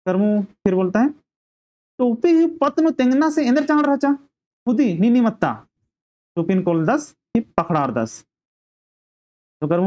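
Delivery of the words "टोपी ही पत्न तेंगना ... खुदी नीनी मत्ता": high-pitched acted grumbling; mock-cross complaint, pitched well up; annoyed. Karmu complains to Dharmu about the cap, annoyed. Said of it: "गुस्सा हो रहा है कर्मू".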